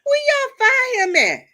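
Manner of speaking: high, loud mock disbelief; exaggerated shocked who-are-you-talking-about; incredulous. Disbelieving reaction to praise.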